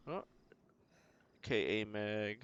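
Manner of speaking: usual speaking voice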